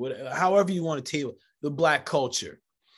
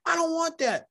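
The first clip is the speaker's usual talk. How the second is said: put-on kid refusal; 14 semitones higher than usual.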